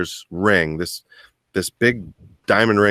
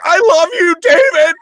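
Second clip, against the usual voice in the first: high, loud, overwrought cry of love; hammed-up lovestruck proposal; 8 dB louder than usual. Practising the proposal on a friend, melodramatic wail. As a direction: ham up a tearful declaration to a buddy.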